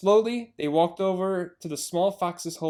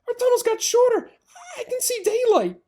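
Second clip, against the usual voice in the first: put-on high, louder child fox voice; excited little fox; 6 dB louder than usual. Small fox telling the family, high excited voice. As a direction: squeal it out like a cub.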